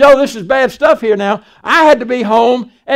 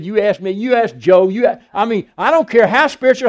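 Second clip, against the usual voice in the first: usual speaking voice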